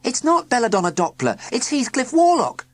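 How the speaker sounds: put-on high, loud children's shout. A narrator's reading. Schoolchildren protesting to the inspectors, shouting high. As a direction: shout it all together. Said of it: "children began shouting".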